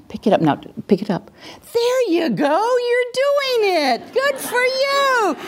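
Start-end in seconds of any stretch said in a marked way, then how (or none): 1.7-5.4 much higher, gushing praise; enthusiastic praise. Shown as a demonstration. Parent praising the child for handing over the remote. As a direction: scoop her up with enthusiasm. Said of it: "scoop her with my enthusiasm".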